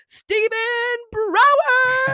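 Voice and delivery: high, loud, drawn-out name; announcer introduction. Real, not acted. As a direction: hype up the guest's entrance. Host announces the guest's entrance.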